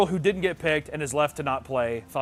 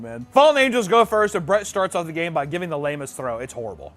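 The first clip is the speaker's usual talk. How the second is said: ordinary talk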